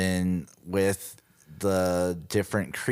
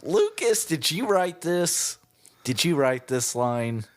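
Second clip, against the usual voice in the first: much higher, disbelieving question; incredulous; 8 semitones higher than usual. Retold or imitated. Host confronting Lucas over the dialogue, incredulous. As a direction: stare down Lucas in shock. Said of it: "And I'm like".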